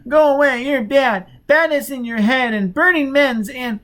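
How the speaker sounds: put-on high, loud wailing child voice. A narrator's reading. Child fumbling the word, wailing high and loud. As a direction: wail it like a terrified little boy. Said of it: "wailing louder".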